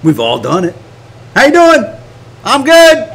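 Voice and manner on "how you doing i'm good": high, punchy, put-on cheer; fake cheeriness. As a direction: trade falsely bright greetings at raised pitch. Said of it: "inside you're in the dark corner".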